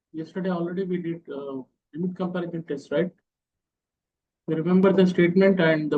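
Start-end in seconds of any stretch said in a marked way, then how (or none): 0.1-3.1 barely audible. Real, not acted. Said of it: "Sir, your voice is very low".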